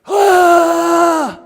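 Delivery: long, loud high yell; imitated battle roar. Opponent stands up for next round, loud yell. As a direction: let out a big charging war cry.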